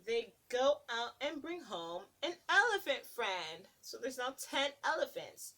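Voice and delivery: put-on lilting read-aloud to little kids; sing-song picture book narration; storybook reading. Reading a picture book to children, lilting.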